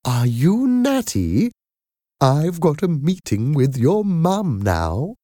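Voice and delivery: sweet motherly nanny; kindly nanny voice. A narrator's reading. Madam Doubtfire voiced.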